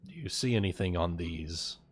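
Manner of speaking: ordinary delivery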